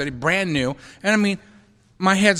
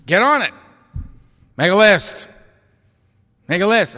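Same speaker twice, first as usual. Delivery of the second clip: louder, blunt command; gruff order; 3 semitones higher, 4 dB louder than usual. Retold or imitated. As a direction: bark it like a sponsor. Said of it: "He made it very clear".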